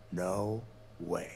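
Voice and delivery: normal talking voice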